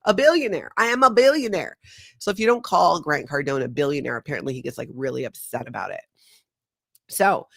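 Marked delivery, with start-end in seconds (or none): 0.1-1.8 louder, higher and fast, getting defensive; mock outraged rich man; indignant insistence. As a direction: protest hotly that you are a billionaire. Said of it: "Grant Cardone's like".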